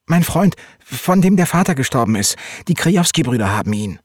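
agitated, high and loud, easing at the end; urgent explanation. Acted. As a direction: plead urgently for your friend. Boy urgently pleading for friend's rescue. (aufgeregt und drängend)

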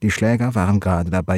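plain reading voice

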